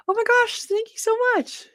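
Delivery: high, loud gushing over the comments; thrilled. Real, not acted. Host gushing to viewers over comments, excited. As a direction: squeal with delight at the new trick.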